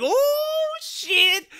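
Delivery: high, squealing Oh shit; excited squeal. Retold or imitated. Fan anticipating the fight, excited squealing. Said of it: "I was sitting here like [name]".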